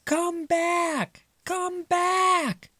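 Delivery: high squeaky mice calling. Acted. Mice calling Father back from the rock, high squeaky shout. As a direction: call across the water in tiny voices. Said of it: "Everyone called to Father".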